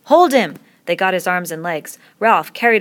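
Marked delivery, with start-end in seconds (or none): 0.0-0.6 high, very loud order; shouted command. Acted. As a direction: yell to the gang. Boys seizing their victim.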